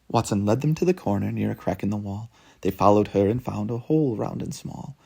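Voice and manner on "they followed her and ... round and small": ordinary delivery, nothing marked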